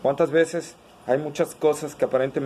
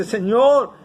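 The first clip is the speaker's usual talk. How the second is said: high, loud cry for help; desperate father calling out to the Lord; desperate plea; 6 semitones higher, 7 dB louder than usual. Acted. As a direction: beg urgently, voice thrown up high. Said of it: "este oficial desesperado le dice".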